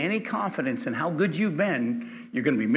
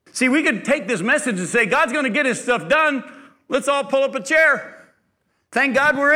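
mock complacent; 9 semitones higher, 6 dB louder than usual. Preacher mocks complacent church attitude.

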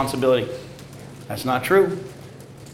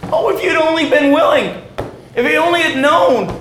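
ordinary talk, nothing marked

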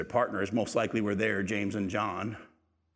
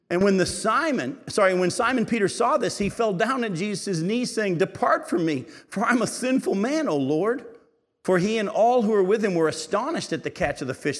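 higher, louder proclaiming Bible reading; scripture reading; 7 semitones higher, 5 dB louder than usual. Acted. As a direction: proclaim the passage to the whole church.

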